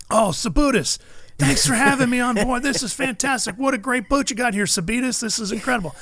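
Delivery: high-pitched, gushing guest impression; John Force. Guest thanks the boat owner for having him aboard, gushing. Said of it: "Force never gets Felix's name right".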